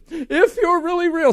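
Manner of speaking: high, loud, drawn-out cry to God; imitating his own tearful shout; retold bawling plea. Preacher retelling his first cry to God, bawling plea. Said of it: "I'm bawling".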